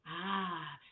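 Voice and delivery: normal talking voice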